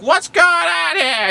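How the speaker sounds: high, drawn-out shriek; playground monitor's yell. Retold or imitated. Scolding monitor grabs both kids, high shouted demand. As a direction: bellow it loud and high, stretching the words. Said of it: "she talked like that".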